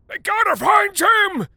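put-on slow, high, loud old man's shout. A narrator's reading.

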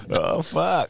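usual speaking voice

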